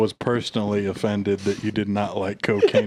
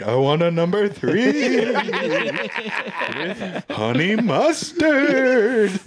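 laughing through an order; 8 semitones higher, much slower than usual. Retold or imitated. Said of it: "And I'm like".

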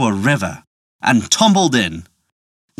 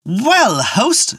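shouting; 9 semitones higher than usual. A narrator's reading. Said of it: "shouted he".

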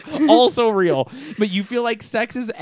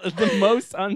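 ordinary talk

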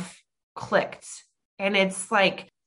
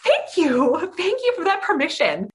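gushing thanks; 10 semitones higher, 3 dB louder than usual. Retold or imitated. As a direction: gush your thanks way up high. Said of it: "And I was like".

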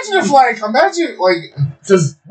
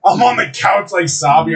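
ordinary delivery

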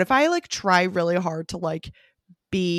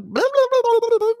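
much higher, squeaky babbling; mock chatter; 4 dB louder than usual. Greeting Chris after a lonely day, high babbling chatter. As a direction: babble nonstop like an eager talker.

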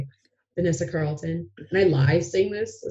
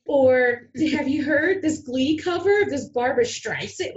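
higher, hopeful sales pitch; eager pitch; 7 semitones higher than usual. Retold or imitated. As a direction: earnestly suggest it in a raised voice. Pitching songs to past bookers.

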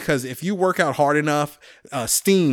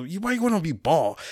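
a higher put-on sergeant voice; imitating a drill sergeant; mock drill sergeant; 4 semitones higher than usual. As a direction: needle the recruit about his bald head. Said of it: "he goes".

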